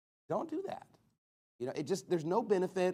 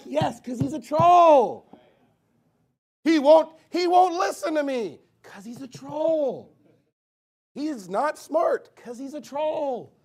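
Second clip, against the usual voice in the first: high, loud, drawn-out hammed-up frustration; mock exasperated yelling; 9 semitones higher, 9 dB louder, slower than usual. Preacher acts out fruitless complaints about trolls, mock exasperated. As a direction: wail the complaint and yell back the obvious answer.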